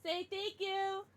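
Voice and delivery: mock ghoulish voice prompting the dog. Playful owner voicing a ghoulish prompt to her dog.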